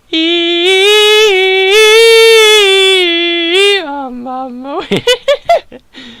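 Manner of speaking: high sing-song laugh held out; long hammed-up laugh. Reacting to Sakura prodding him, sung laughter.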